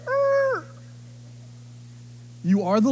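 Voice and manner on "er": a startled high "er"; high awkward er. Retold or imitated. God takes the sarcasm literally, a high awkward er. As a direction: caught out, squeak an unsure er. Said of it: "You're like".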